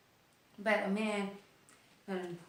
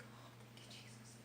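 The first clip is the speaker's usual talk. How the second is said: breathless whispered prayer of relief; a real whispered prayer after the pain, thanking Jesus; whispered thanks; 12 dB quieter than usual. Real, not acted. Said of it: "Just like I'm doing right now".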